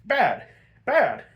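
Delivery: mock bodyguard yelling at a kid; gruff bodyguards. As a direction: shoo the kid off like a bouncer. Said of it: "and they're like".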